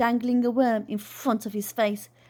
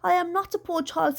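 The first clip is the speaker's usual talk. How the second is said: muffled; 6 semitones higher than usual. Acted. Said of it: "in a muffled voice".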